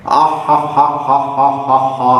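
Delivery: hammed-up boastful laugh; haughty fake laugh. Shown as a demonstration. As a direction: laugh like a smug rich kid. Acted boastful rich kid's laugh.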